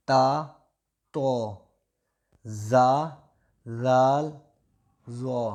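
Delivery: teacher spelling out each letter loud and distinct; Arabic letter names. Shown as a demonstration. Teacher reading Arabic letters aloud to a child, loud and deliberate. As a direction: call out each Arabic letter separately for the student. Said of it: "یہ کاف break آواز میں پڑھنا ہے".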